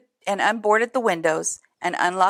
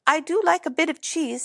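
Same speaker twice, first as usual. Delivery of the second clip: mouse voice; 7 semitones higher than usual. A narrator's reading. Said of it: "said the mouse".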